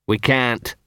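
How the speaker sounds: ordinary delivery, nothing marked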